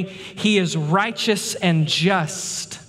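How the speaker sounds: drawn out very slowly, each word weighed; slow emphasis. Acted. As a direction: let every word land, very slow. Preacher stresses God's justice.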